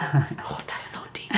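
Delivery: breathy teasing whisper. Pretend. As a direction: hush it out in mock awe. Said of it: "Is that too deep".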